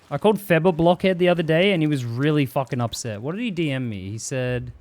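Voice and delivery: ordinary talk, nothing marked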